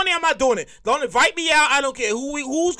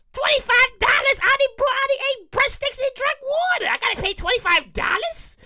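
high, indignant complaining; outraged cheapskate; 9 semitones higher than usual. Retold or imitated. Diner refusing to pay the split bill, high indignant whine. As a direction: protest the split bill in disbelief. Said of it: "They got a problem".

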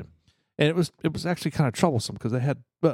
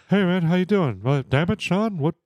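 Hank Hill voice; 5 semitones higher than usual. Retold or imitated. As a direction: lightly voice the neighbor like Hank Hill. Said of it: "He sounds just like Hank Hill".

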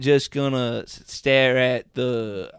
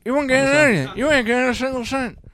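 put-on high, loud old-man lecture about going to work; Joe Biden impression; 8 semitones higher, 6 dB louder than usual. Mock Biden telling a broke listener to get to work, high and loud. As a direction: wag a finger like a cranky old president. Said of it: "this is my Biden".

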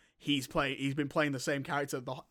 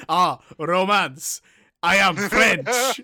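slow, hammy fake French accent, louder; Bruce Campbell's bad French accent; 10 dB louder, much slower than usual. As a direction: drawl it out in a terrible French accent. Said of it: "like, the worst French accent".

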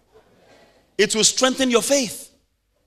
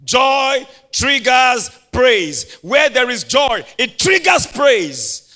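very slow, louder proclaiming; slow pulpit shout; slow loud emphatic; 2 semitones higher, 6 dB louder, much slower than usual. Real, not acted. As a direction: hammer home the point. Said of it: "This is where I'm gonna stop".

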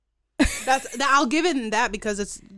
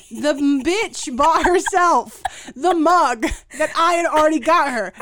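louder, higher exasperated complaint; annoyed rant; 6 semitones higher, 7 dB louder than usual. Real, not acted. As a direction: vent about the sister's blunder. Angry retelling of sister's purchase.

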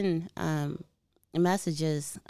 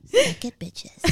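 mock tough taunt; 6 dB louder, slower than usual. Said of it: "You're never gonna sound threatened with that voice".

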